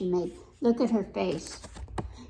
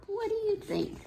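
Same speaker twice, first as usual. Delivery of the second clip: inviting question; 12 semitones higher, 4 dB quieter than usual. Real, not acted. Asking the child listener.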